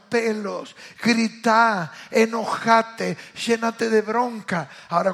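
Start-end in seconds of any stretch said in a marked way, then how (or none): none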